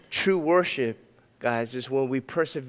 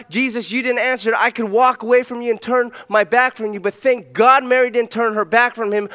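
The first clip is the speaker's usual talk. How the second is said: higher, louder fervent preaching; full preaching; 7 semitones higher, 6 dB louder than usual. Acted. Pastor to his congregation, preaching at full force. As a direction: preach it out to the whole room.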